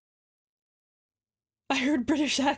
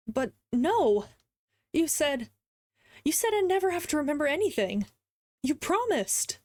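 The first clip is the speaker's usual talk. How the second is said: put-on higher, hurt, pleading young man; upset protest; 4 semitones higher than usual. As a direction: plead that he was promised.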